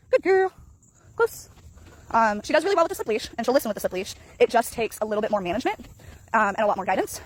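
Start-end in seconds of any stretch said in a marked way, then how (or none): 0.1-1.5 much higher, louder praise for the dog. Real, not acted. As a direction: cheer the dog on, sing-song high. Praising her dog on a walk.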